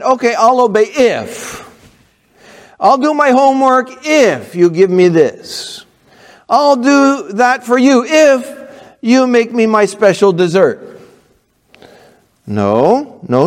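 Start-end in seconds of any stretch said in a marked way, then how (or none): 0.0-11.0 put-on higher, wheedling child voice; bargaining kid. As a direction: play a kid trying to strike a deal. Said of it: "You're not gonna say to your mom and dad".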